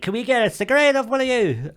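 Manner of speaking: put-on high, sing-out girl voice; mimicked girls. Girls asking comedians for a cigarette, mocking high voice. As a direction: put on a high-pitched cadging voice.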